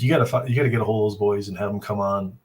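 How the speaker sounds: ordinary delivery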